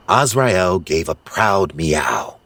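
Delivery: usual speaking voice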